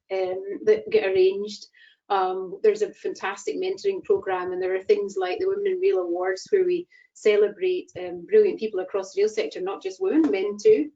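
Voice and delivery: ordinary talk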